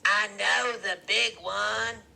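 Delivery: high, loud, drawn-out declaration; voiced woman character. A narrator's reading. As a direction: call it out slowly, high and loud.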